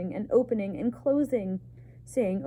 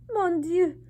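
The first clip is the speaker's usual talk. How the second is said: high, loud French exclamation; mother shouting in alarm, French; mother's shout; 10 semitones higher, 5 dB louder than usual. A narrator's reading. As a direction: cry out in French horror. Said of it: "shouted his mother".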